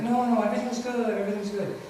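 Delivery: high-pitched breezy reassurance; insistent cheeriness. Acted. Suicidal friend reassuring a caller, over-cheerful. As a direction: brush off worry brightly. Depressed friend acting suddenly happy.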